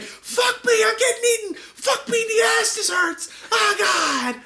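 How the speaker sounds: very high, loud mock death screams; agonized screaming. Comic victim being eaten by a hippo, agonized screaming. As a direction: scream in hammed-up agony.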